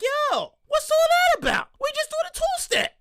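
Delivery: squeaky, high indignant voice; high mock protest. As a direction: complain way up high, offended. I'm like, protesting.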